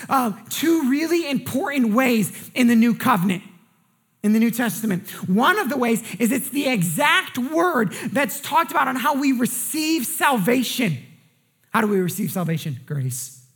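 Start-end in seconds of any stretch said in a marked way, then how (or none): none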